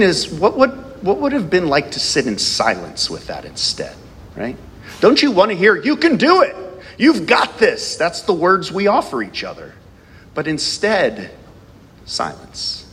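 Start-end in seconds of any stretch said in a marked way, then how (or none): 5.8-8.0 encouraging cheer. Retold or imitated. Encouraging words we offer.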